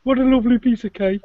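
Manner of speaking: put-on Birmingham accent. Friend teasing about Birmingham, mock Birmingham accent. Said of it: "next door to Birmingham".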